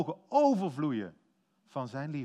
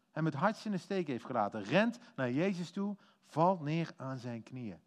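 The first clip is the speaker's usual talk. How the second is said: ordinary talk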